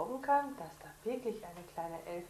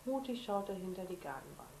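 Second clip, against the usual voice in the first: plain reading voice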